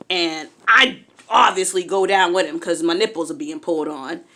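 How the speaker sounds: ordinary talk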